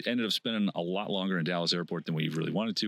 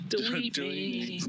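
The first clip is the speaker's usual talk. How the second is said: robotic slow voice; 12 semitones higher, much slower than usual. Pretend. Echoed joke, slow and high.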